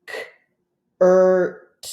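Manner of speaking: K-ert split into its sounds, tapped; K-ert sounded out slowly, sound by sound. Shown as a demonstration. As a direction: say curt as separate sounds, K then ert. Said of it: "tap it out".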